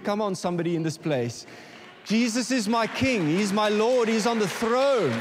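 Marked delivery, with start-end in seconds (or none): 2.0-5.1 louder and higher, proclaiming; loud proclamation. Real, not acted. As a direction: declare it out to the whole church. Preacher proclaims at full force.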